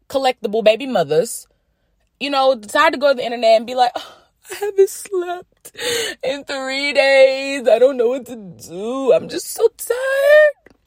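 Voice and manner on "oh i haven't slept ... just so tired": high, drawn-out fake crying; mock tearful whining. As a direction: wail it slowly, hamming up the exhaustion. Mocking tearful mother's internet complaint.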